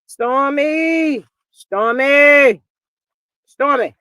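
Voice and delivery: loud call across the room, drawn out. Acted. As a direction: hail her from afar to get her attention. Calling out to Stormy.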